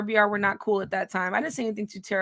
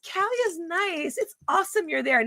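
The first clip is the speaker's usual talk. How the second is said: mocking him; 6 semitones higher than usual. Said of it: "He says".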